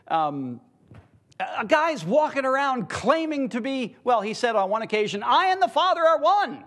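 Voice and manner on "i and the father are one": high, ringing declaration of a claim; bold proclamation. Acted. Preacher quoting Jesus' absurd-sounding claim, boldly proclaimed.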